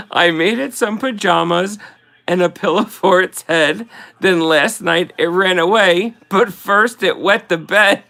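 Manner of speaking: barely holding the poem together through giggles; reading a silly poem while laughing. Real, not acted. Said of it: "I'm laughing so hard".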